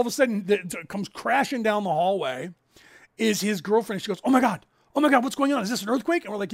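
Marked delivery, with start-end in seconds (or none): 4.2-6.2 mock panic. Girlfriend panicking in earthquake.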